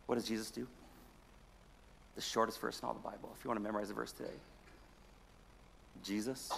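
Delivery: low, soft storytelling with long pauses; hushed, somber preaching. A narrator's reading. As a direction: let the sad story sink in, very quietly.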